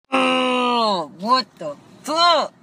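loud, high, slow disbelief; outraged outburst. Real, not acted. Venting to the viewers, loud and outraged. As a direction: let the outrage burst out.

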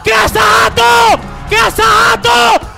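screaming goal call at full power; explosive goal commentary. Real, not acted. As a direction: erupt into a long ecstatic goal shout. Goal scored, commentator erupts.

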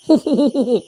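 a hearty loud burst of laughter. Acted. Boy laughing loudly at his friend's joke. Friends joking after advice.

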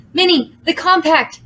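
high, urgent hissed call. A narrator's reading. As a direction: hiss it urgently. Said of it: "hissed Aru".

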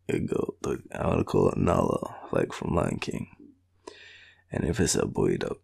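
normal talking voice

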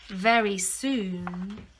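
plain reading voice

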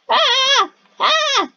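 short high-pitched screams; panicked scream. Pretend. Player hurtling through the game, panicked scream. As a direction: yelp in alarm, high and open.

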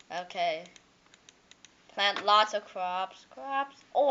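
normal talking voice

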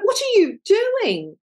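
high, slow, shocked question; retold dismay. Mentor catching the artist walking on her canvas, shocked and slow. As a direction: ask it aghast, drawn out.

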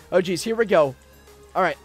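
ordinary delivery

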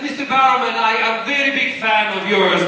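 put-on German accent slipping into Russian; accidental Russian accent. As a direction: imitate a fan with a wobbly Russian-sounding accent. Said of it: "Now he's Russian".

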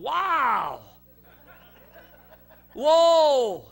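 long, drawn-out high cry of amazement; loud retold astonishment; awestruck exclamation. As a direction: marvel loudly, stretching the vowel. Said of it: "I'm looking and I'm going".

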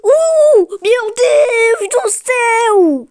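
loud, high, drawn-out wail; overwhelmed outcry. Real, not acted. Gamer reeling from a close round, long high wail. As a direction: stretch the exclamation slowly at full voice. Narrow moment in the game.

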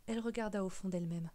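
ordinary delivery, nothing marked

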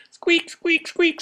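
very high squeaks; squeaking anteater. Acted. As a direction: squeak like a tiny animal. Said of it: "says the anteater".

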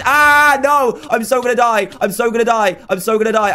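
high-pitched yelp easing into frantic repeats; panicked wail. Real, not acted. Gamer about to die in a match, panicked wail. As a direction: start at a loud cry, then keep fretting high.

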